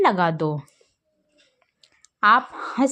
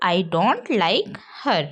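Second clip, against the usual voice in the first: very slow English sentence; drilled sentence; slow teaching model; much slower than usual. Shown as a demonstration. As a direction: say it slowly for the class. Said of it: "तो".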